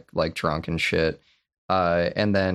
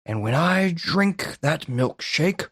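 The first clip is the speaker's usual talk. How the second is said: hammed-up Daniel Plainview; 9 semitones higher than usual. Retelling the milkshake speech to his co-host, hammed-up and gloating. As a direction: savour each word like a gloating villain. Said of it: "He goes".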